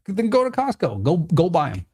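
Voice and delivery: high, sharp brush-off; a fed-up high-pitched get-lost; exasperated dismissal. Retold or imitated. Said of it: "just like that".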